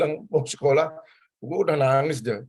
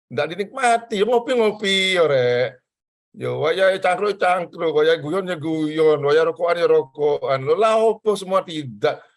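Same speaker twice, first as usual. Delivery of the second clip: higher, louder teasing appeal; lively mock protest; animated; 4 semitones higher, 4 dB louder, slower than usual. Real, not acted. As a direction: urge them to enjoy coffee and hanging out. Urging enjoyment of life.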